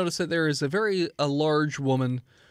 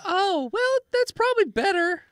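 high innkeeper voice; 14 semitones higher, 3 dB louder than usual. Acted. Innkeeper women replying.

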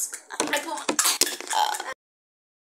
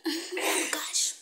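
laughing whisper; 5 semitones higher, 8 dB quieter than usual. Real, not acted.

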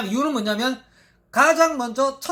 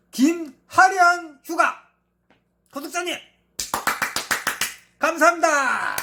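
hyped giveaway announcement; 4 semitones higher than usual. Real, not acted. Announcing winner name.

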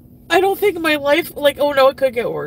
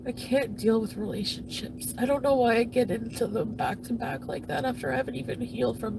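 softer, worn-out confession; drained and hurt; 2 semitones lower, 7 dB quieter than usual. Real, not acted. Confessing to viewers she cannot cope, quietly broken. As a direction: let it sag, exhausted.

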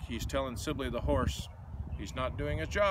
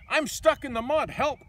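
higher, louder panicked call for help; cry for help; 11 semitones higher, 7 dB louder than usual. Acted. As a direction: call out stuck and scared. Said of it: "cried Deke".

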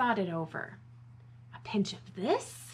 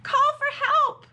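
high, loud panicked cry; storybook cry for help; 10 dB louder than usual. A narrator's reading. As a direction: raise the alarm. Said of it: "cried Bibi".

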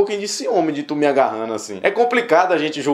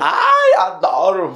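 mock camp exclamation; camp squeal; much slower than usual. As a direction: squeal it with hammed-up delight.

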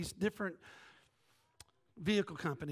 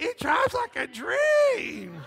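much higher, loud, slow, delighted shout; mom's delight; 10 dB louder, much slower than usual. Retold or imitated. Mom praising her new car, high slow delighted shout. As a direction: belt it out like a thrilled mom.

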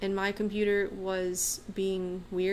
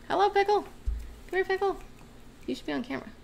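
pet voice; 9 semitones higher, faster than usual. Real, not acted. Greeting her cat on camera.

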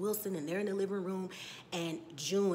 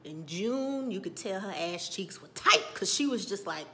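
ordinary talk

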